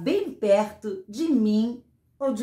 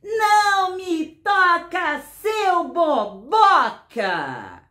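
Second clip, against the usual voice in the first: high, drawn-out rallying yell; sing-out shout, falling at the end; 8 semitones higher, 4 dB louder, much slower than usual. Acted. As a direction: stretch it into a slow chant for children to join. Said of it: "pode gritar também".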